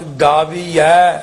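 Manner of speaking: slow ਗਾਵੀਐ ending in an open ai; drawn-out ai ending. Shown as a demonstration. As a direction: hold the double-lavan ai at the end of ਗਾਵੀਐ. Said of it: "ਐੜੇ ਦਲਾਈਆਂ".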